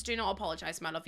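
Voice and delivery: ordinary talk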